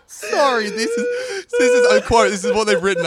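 high, breathless laughter through words; can barely get the words out for laughing; real laughter breaking the sentence; laughing hard. Real, not acted. Cracking up at the article.

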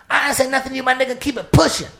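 high-pitched, loud, agitated denial; street lady's brush-off. Retold or imitated. Street lady dismisses the narrator's question, high, loud and snappy. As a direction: put on the ranting woman sending him away. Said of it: "She said".

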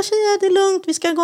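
high, bright reassurance; calm them with a lifted voice; soothing the girls. Retold or imitated. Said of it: "jag bara".

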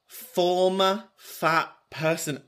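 slow, deliberate repeat. Real, not acted. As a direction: spell it out slowly and softly. Repeats the discovery to herself.

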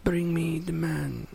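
slow, soft mock Italian accent; weak pope in a hammed-up Italian accent; labored Italian-accented croak from a sickbed. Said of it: "Why does he have an Italian accent?".

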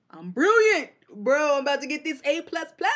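put-on high, loud, cocky teen boast; cocky excitement. Teen imagines acing remix assignment, cocky excitement. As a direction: crow about the sure A plus.

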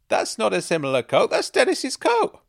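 loud, climbing to a very high pitch; blurted outburst. A narrator's reading. Woman recognising Dennis's coat on the narrator, blurting loudly. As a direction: hysterical disbelief, stretched out at the end. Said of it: "laughing once again".

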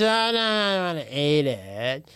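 put-on babyish refusal. [name] refusing food like a baby.

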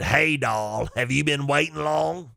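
put-on rasp of a lifelong smoker; older woman's smoker voice. Older woman greets her date, in a smoker's voice. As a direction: imitate a chain-smoking older lady. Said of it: "this is the way she sounded".

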